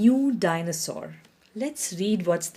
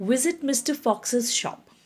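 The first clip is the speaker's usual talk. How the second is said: plain reading voice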